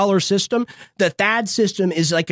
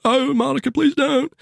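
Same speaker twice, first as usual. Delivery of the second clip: Bill Clinton impression; 3 semitones higher than usual. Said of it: "pretty good Bill Clinton impression".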